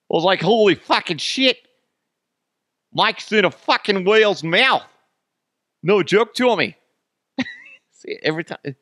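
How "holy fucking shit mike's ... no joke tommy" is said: put-on loud, high Boston accent veering Australian; hammed-up Boston guy, accent slipping Australian; botched Boston accent. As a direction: yell it in a failed Boston accent. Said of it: "like, Australian kind of thing".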